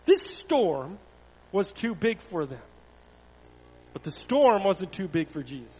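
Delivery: animated storytelling that rises and falls with the drama; story narration. A narrator's reading. Pastor reads a Bible story to children, animated storytelling. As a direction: read it to children, pausing for effect.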